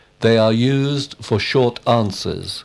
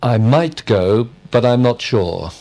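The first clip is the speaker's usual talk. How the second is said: "might" stressed with emphasis. Shown as a demonstration. Student answering with an emphatic example, stress on "might". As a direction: punch the word "might". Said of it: "when we wish to be emphatic".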